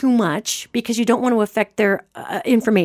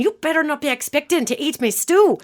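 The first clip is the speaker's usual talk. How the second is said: mock yelling; 7 semitones higher than usual. Medium imitating ghost wife guarding her stew, mock yelling. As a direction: scold like an indignant cook, pitch pushed up. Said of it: "She kept yelling at me".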